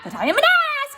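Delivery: ass hit high and hard; stress on ass. Shown as a demonstration. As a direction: That I am an ass, punching ass. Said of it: "emphasize that word ass".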